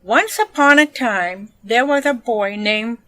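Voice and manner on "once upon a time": plain reading voice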